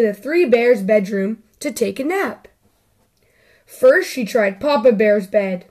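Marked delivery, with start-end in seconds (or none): none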